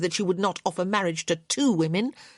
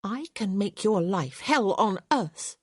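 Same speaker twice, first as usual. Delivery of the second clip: dangerous threat; 4 semitones higher than usual. A narrator's reading. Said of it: "her eyes flashing dangerously".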